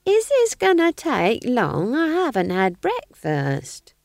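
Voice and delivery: slow witch voice, high at first; Nanny's character voice. A narrator's reading. Witch prisoner unimpressed by torture, slow role voice. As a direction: drag it out, unimpressed. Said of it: "said Nanny".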